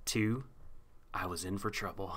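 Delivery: quiet, quick hard-boiled narration; noir detective narrator. Retold or imitated. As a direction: narrate it like a pulp private eye. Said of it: "became the narrator".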